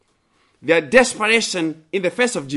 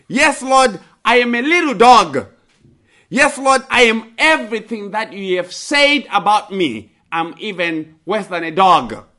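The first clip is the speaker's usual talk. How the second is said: much higher, louder self-abasing plea; humble plea; 5 semitones higher, 5 dB louder than usual. Acted. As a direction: plead humbly, giving in completely. Woman humbles herself before Jesus.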